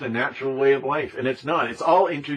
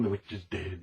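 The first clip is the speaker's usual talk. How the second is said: softly sung, much quieter; quiet singsong; 5 semitones lower, 9 dB quieter than usual. Pretend. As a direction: let it drop to a soft little tune. Sings after the jingle.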